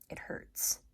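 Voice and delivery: usual speaking voice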